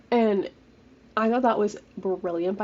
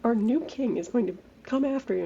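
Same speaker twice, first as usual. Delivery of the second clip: lightly voiced guards; 3 semitones higher than usual. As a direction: draw it out like a lightly mocked warning. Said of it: "when they were like".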